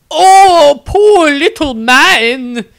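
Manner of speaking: high, loud, syrupy nun voice; zealous nun. A narrator's reading. Nun Angelique sweetly insists to the wizard, high and loud.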